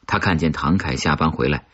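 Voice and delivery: ordinary delivery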